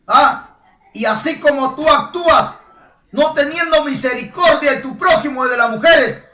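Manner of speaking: fiery sermon voice; preaching at full force. Acted. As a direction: hammer it home like a pulpit preacher. Preacher building to shouted rebuke.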